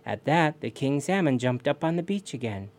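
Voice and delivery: ordinary delivery, nothing marked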